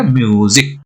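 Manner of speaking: म्यूजिक with a drawn-out 'myoo'; stretched long vowel. Shown as a demonstration. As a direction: pull the यू outward in म्यूजिक. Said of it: "बाहर की तरह खींचता है". (म्यूजिक में खिंचा हुआ यू)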